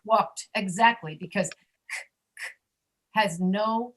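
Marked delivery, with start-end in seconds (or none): 1.5-2.5 K, K as bare clicks of air, no voice; voiceless K sound. Shown as a demonstration. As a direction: make an isolated unvoiced "k" twice. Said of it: "has no voice to it".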